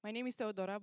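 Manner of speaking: ordinary talk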